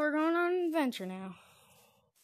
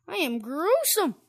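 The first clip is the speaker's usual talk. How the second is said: gleeful; 5 semitones higher, 11 dB louder than usual. Real, not acted. Gleeful after stabbing.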